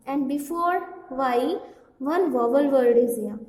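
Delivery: slow and loud, every word leaned on; drawn-out classroom emphasis; slow emphatic rule. Shown as a demonstration. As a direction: spell the rule out deliberately for the class. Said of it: "teacher stressing the rule".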